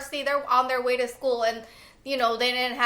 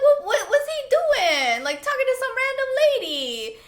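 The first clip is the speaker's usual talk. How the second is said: high and loud, laughing in disbelief; incredulous laughing exclaim; 13 semitones higher, 4 dB louder than usual. Retold or imitated. Watching Justin talk to a stranger, laughing high. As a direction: squeal it out, amused and baffled. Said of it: "I'm like".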